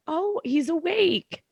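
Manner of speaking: high, slow, pleasantly surprised "oh"; surprised nurse. Retold or imitated. Nurse noticing the sedated father has woken, surprised and high. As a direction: let it lift up in surprise, drawn out. Nurse sees dad wake up.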